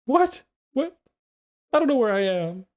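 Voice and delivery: high, loud, bewildered animal voice; confused camel voice. Acted. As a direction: squeak it out like a lost camel.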